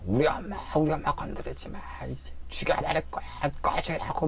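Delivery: high, hissed angry threat; furious cursing. Retold or imitated. Patient turning on the ghost, high furious hiss. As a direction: spit the threat in a strained whisper. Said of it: "막 욕을 하면서".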